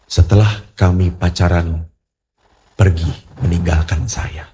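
low, halting retelling of a painful secret; solemn confession. Retold or imitated. Young woman confesses her past to the pastor, low and solemn. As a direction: confess it gravely in low, separated phrases.

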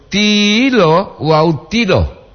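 first tilo with a long high I, second tilo short; long versus short vowel. Shown as a demonstration. Host comparing two lookalike words, long then short. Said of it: "Disamakan boti Allah perbandingan Leo".

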